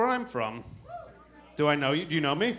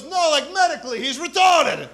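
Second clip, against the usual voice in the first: high, loud, emphatic holler; insistent calling out; 9 semitones higher, 5 dB louder than usual. Retold or imitated. Imitating cop insisting to his partner, high loud call. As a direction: insist to your partner, pitched way up.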